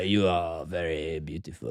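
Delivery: a hint of a low French charmer; slow seductive Serge. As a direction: purr the compliment slowly. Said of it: "he's like".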